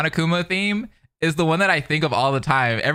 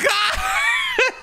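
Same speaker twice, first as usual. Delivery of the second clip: shrieking laugh. Real, not acted. Reacting to a sudden punch.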